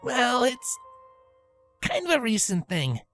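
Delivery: high voice, halting and sheepish; sheepish, hesitant explanation. A narrator's reading. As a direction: admit it awkwardly, with a hesitation. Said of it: "Rainbow stated sheepishly".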